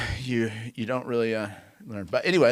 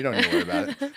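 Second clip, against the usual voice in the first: laughing reassurance; much faster than usual. Retold or imitated. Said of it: "he's like".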